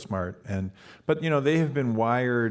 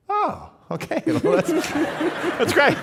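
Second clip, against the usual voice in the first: high, louder, laughing relief; imitating a relieved student; 5 dB louder than usual. Student learns she needn't become a doctor, laughing and high. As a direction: burst out with giddy relief.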